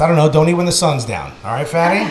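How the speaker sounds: usual speaking voice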